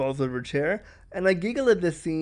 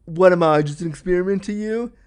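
loud, hurt accusation; angry boyfriend; 3 semitones higher, 7 dB louder than usual. Retold or imitated. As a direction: throw it at her like a wounded ex. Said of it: "And he was like".

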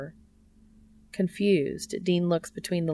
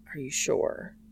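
unvoiced, soft; hushed; 2 semitones lower, 3 dB quieter than usual. Cass checking with Dean, hushed whisper. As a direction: ask it in a hush.

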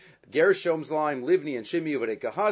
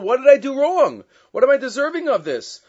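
loud, high, pained cry of why; anguished plea; 10 semitones higher, 8 dB louder than usual. Acted. Dying rabbi cries out to his student in anguish. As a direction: plead it up high like a dying man asking why.

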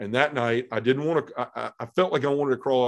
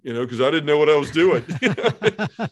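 laughing; 5 semitones higher, 7 dB louder than usual. Real, not acted. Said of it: "listener laughs".

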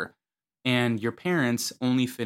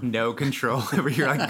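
ordinary talk

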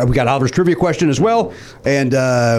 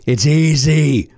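very slow, stretched-out "easy"; hammed-up, drawn-out ad line; drawn-out salesmanship; much slower than usual. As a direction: stretch each word for the sales pitch.

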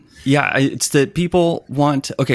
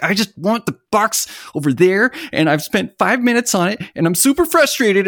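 higher, whining complaint that climbs to the end; frustrated whine; 8 semitones higher than usual. Retold or imitated. Developers complaining about moving a box, in a mock frustrated whine. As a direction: whine it like a fed-up beginner. People fighting CSS box placement.

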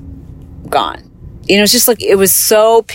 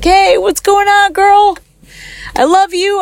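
much higher, slow, affectionate greeting; uncle's happy voice; 14 semitones higher than usual. Retold or imitated. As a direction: imitate the uncle calling her "girl" cheerfully. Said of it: "He's like".

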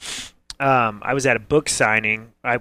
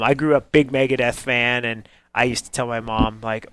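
ordinary delivery

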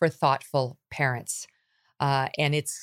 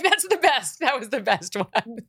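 higher, laughing with delight; 6 semitones higher than usual. Retold or imitated. A mother praising her son's comeback, laughing. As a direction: crack up with pride at his answer. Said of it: "I'm like".

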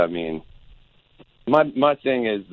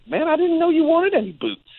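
mock surprised friend; 4 dB louder than usual. Friend's surprised reply, voiced high.

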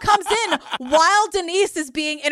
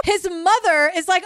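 normal talking voice

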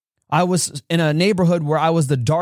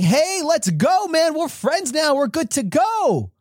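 put-on imagined friendly kids; slower than usual. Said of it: "going to be like".

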